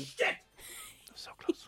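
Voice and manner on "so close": hushed whisper. Pretend. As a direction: whisper it under your breath. Accent slipped, caught out.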